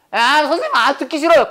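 hammed-up student complaint; complaining student. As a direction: whine to the teacher like a reluctant pupil. Acts a student refusing to listen.

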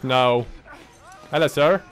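ordinary delivery

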